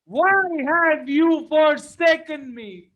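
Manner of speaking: desperate shouted lament in prayer; anguished prayer. Acted. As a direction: cry out to God like a desperate believer. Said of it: "and saying that".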